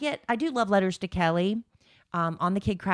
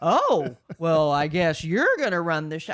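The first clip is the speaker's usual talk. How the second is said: imitating a put-out partner, loud opening; exaggerated huffy retort; mock huffy imitation; 4 dB louder than usual. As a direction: mimic him taking offense. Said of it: "he's like".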